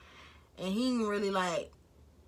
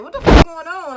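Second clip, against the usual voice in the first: high, loud what-the-fuck outburst; loud retold disbelief; mock outraged yell; 9 semitones higher, 15 dB louder than usual. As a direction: blurt it out shocked and loud. Retold outburst at dark room.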